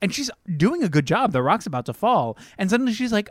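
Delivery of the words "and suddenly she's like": ordinary talk, nothing marked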